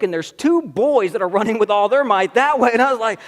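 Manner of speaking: ordinary talk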